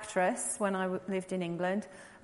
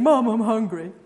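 louder, imitating a kid begging for food; whiny hungry kid; 8 dB louder than usual. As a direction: do the boys nagging their mum. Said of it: "the boys are always opening it saying".

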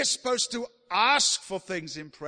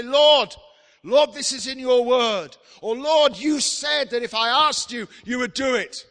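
louder, higher pleading cry to the Lord; impassioned pleading prayer; 5 semitones higher, 9 dB louder than usual. Acted. Preacher voicing a believer's prayer to God, loud pleading.

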